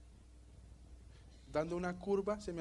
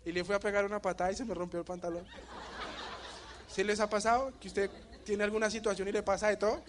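loud, laughing retelling; laughing loudly; 4 semitones higher, 5 dB louder than usual. Real, not acted. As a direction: laugh through the mishap, louder. Cuenta riendo y fuerte. (riendo a carcajadas al contarle al público)

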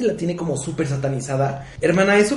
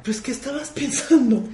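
mocking disbelief; 11 semitones higher than usual. Mock question to past artists.

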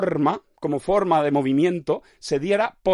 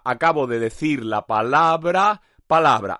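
slowed down, palabra palabra spelled out; slow it right down for the tongue twister; slow, deliberate demo of the phrase; slow careful repeat; 3 semitones lower, slower than usual. Shown as a demonstration.

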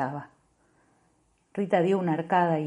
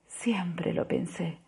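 slow unvoiced whisper; soft slow whisper; 8 dB quieter, much slower than usual. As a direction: whisper it, worn out. Woman answering, whispered.

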